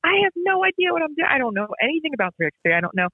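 high-pitched mock panic, rushing at the end; panicked. Retelling her panic to her husband Sean after the call, high and frantic. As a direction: squeal it out in disbelief, like the floor just dropped. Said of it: "and I'm like".

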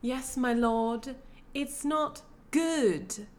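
slow, high, drawn-out mock British accent; shaky British accent. Mimicking an actress addressing her lord, mock British accent. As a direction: imitate a botched British accent, very slowly. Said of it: "there was one point where she's like".

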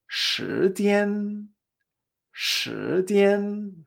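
"shijian" rising, then high and level; repeat-after-me "shijian" with lifted first syllable; tones two-one. Shown as a demonstration. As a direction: stretch "shijian", holding the last syllable high. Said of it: "es la misma entonación".

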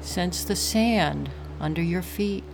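calm, unhurried breathing guide; guided meditation. Acted.

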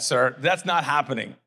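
ordinary talk, nothing marked

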